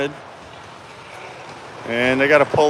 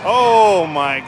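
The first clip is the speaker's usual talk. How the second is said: excited shout; 5 semitones higher, slower than usual. Real, not acted. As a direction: yell the save, then stretch it out. Scramble and save at net.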